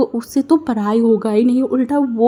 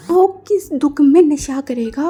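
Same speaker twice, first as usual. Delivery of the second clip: slow, higher, put-out; indignant retort; 4 semitones higher, 4 dB louder, slower than usual. Acted. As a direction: ask it offended and drawn out. (नाराज़ होकर धीरे से पलटकर पूछना)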